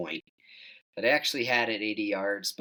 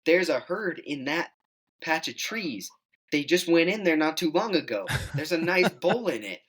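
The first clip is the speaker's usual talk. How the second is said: retold yell; 8 semitones higher than usual. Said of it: "but he yells".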